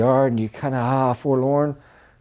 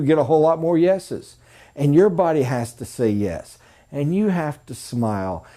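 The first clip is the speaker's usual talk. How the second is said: normal talking voice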